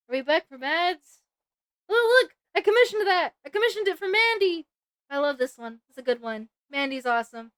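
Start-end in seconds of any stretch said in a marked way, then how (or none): 1.9-4.7 excited. Real, not acted. Excited at commissioned art.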